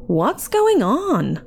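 high, slow, swooping question; stretched, alarmed asking; concerned mother. A narrator's reading. Sue's mom walks into trouble, alarmed. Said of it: "Sue's mom asked".

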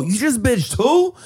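high, slow disbelieving question; stunned you just bitched who; incredulous. Retold or imitated. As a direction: ask it like you can't believe your ears. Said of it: "I go".

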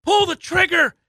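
a scout selling the pick with total bravado; shouting the pick's praises; hyped shouting scouts. Retold or imitated. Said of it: "are like".

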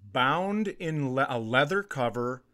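ordinary talk, nothing marked

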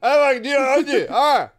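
put-on loud, high drunken ranting; drunk angry rant. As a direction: bellow it like a furious drunk. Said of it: "He's going".

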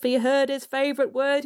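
usual speaking voice

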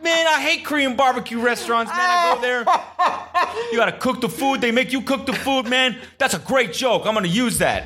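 mock Jersey accent. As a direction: shout it like a drunk Jersey guy. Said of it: "He's like".

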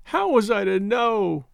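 slow, high tearful wail; mildly tearful lament; crying. A narrator's reading. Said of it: "Loretta was crying".

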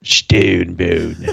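low grinding stone gravel voice; gargoyle voice. Pretend. Echoes gargoyle voice back.

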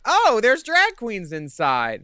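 slow, loud, breathy announcement; mock reality show voice. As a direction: drawl it like a TV tease.

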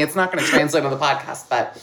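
normal talking voice

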